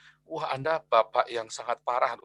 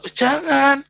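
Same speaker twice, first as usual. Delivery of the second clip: firm counsel, pitched higher and louder; preacher replaying his plea to a parent, higher and louder; retold urgent advice; 8 semitones higher, 4 dB louder than usual. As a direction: urge the harsh father to stop and explain. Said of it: "Terus saya bilang".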